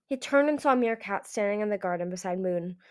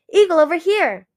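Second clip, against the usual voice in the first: high, loud, eager hail; excited shout; 8 semitones higher, 12 dB louder than usual. A narrator's reading. Hailstorm waves down his old comrade, calling excitedly. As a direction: call them over, full of excitement. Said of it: "his voice full of excitement".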